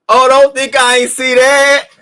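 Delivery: high, loud, slow knowing tease; loud slowed-down gotcha; teasing emphasis. Real, not acted. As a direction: draw it out high like you caught them. Repeats line for emphasis.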